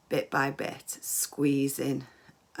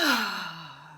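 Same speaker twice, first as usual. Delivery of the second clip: long relieved exhale; big sigh. Shown as a demonstration. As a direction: let out a big, loose sigh. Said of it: "lovely big sigh as we relax".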